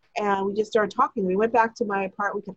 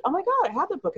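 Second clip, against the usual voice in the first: excited; 9 semitones higher, faster than usual. Retold or imitated. Excited coincidence.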